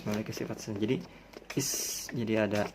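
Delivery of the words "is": is with a long voiceless hiss. Shown as a demonstration. Teacher to students, hissing s demo. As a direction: let is hiss out. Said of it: "ada desisnya".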